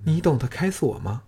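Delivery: put-on a young woman surprised he can pick locks; surprised question. A narrator's reading. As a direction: ask it in wide-eyed wonder. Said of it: "奇道".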